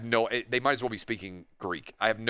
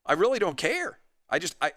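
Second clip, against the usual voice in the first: much higher, a laugh breaking in, mock outrage at the world; exasperated disbelief; 8 semitones higher than usual. Patient reacting to a preachy dentist, mock-exasperated high disbelief. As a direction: throw your hands up at the absurdity, voice climbing. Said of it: "would you be like".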